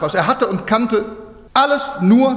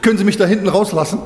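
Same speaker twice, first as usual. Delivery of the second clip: laughing mock plea; faster than usual. Hitchhiker wants out, laughing.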